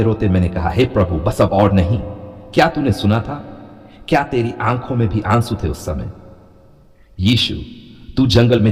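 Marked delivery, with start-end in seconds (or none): none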